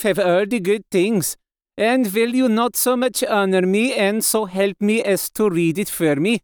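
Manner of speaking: put-on foreign-accented old professor, pleading politely; accented foreign doctor, courteous. Doctor begs Mina to read it for him, courteous accented voice. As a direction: play the courteous foreign doctor asking a favour.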